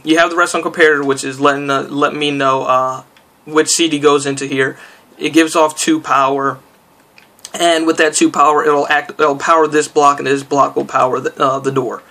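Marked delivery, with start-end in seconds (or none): none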